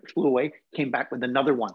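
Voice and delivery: normal talking voice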